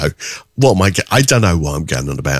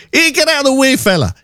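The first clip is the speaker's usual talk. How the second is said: bad Scottish accent. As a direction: shout it in a poor Scottish accent. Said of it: "I can't do a Scottish accent".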